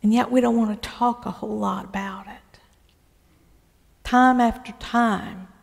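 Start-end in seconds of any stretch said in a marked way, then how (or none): 0.0-2.6 whisper. Real, not acted. Rueful aside to the congregation.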